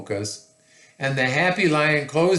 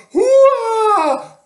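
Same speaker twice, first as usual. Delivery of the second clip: very high, loud shriek; hammed-up swooping wail of fright; ladies' frightened cry; 11 dB louder than usual. A narrator's reading. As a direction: scream like startled ladies in a storybook. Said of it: "cried the ladies".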